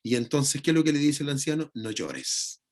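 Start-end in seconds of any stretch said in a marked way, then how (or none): 1.7-2.6 quiet, low, tender comfort; consoling. Retold or imitated. Elder soothing John in his tears, tender. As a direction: hush a crying person kindly. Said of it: "Lo consuela".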